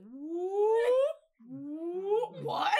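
loud high whooping siren; siren imitation. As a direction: whoop like an alarm. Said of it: "it'd be like".

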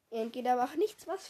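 usual speaking voice

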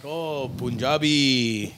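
stalling bluff. Retold or imitated. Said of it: "'cause you don't know anymore".

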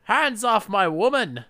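high, reedy, hammed-up shout; reedy Invisible Man. Invisible man yelling at the couple, high and reedy. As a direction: bluster like an old-film villain. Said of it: "made his voice all reedy".

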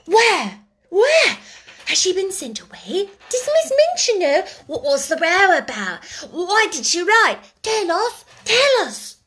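high, excited schoolgirls clamouring; breathless, high-pitched clamour; babel of questions. A narrator's reading. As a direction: fire off urgent questions one on another. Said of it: "There was a perfect babel".

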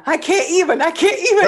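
high, loud exasperated cry with a laugh; overwhelmed exclaim. Retold or imitated. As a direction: throw up your hands, laughing. Mother of two sets of twins.

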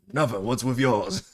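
a light imitation of a London British accent. Butcher shrugging off the FBI in a lightly mimicked British accent. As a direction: toss it back in a mild British accent. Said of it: "that British accent for Butcher".

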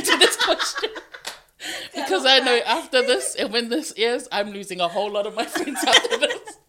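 laughing nervously through the words; nervous laughter. Real, not acted. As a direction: giggle out of real nerves. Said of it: "I'm laughing because I'm nervous".

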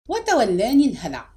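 plain reading voice

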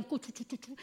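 very soft imitation of secretive talk; parents' hushed chatter. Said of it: "mis padres hablaban y decían".

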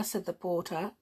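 plain reading voice

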